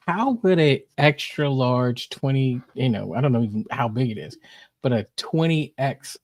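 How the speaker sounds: ordinary talk, nothing marked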